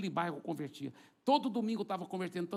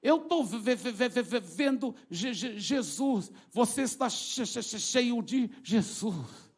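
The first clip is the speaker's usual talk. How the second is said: mock terrified stammer, pitched up; trembling demon stutter; 6 semitones higher, 3 dB louder, slower than usual. Demon pointing at the preacher, trembling and stammering in fear. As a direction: stutter shakily on the first syllables.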